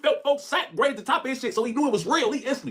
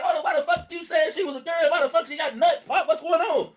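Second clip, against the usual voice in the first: put-on lightly raised kid voice; a hint of a puzzled boy; confused son; 5 semitones higher than usual. Son asking his father, lightly pitched up and confused. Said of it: "Some son gonna be confused".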